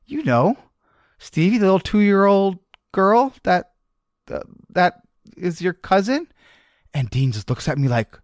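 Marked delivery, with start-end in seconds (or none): 0.1-6.3 mock earnest. Dad teasing son with fake cousin.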